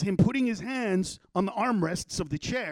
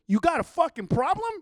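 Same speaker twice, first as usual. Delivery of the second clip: retold confrontation, higher pitch; aggressive challenge; 6 semitones higher, 4 dB louder than usual. Man confronting a bystander at the door, aggressive challenge. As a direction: square up to someone with the question.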